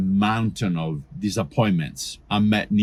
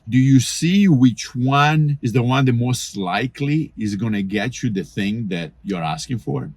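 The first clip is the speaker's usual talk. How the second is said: ordinary talk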